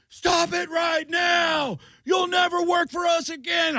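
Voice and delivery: high, drawn-out mock shout from afar; heckling shout. Bookers heckling the comic from back of room, shouting. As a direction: yell it high like furious bookers.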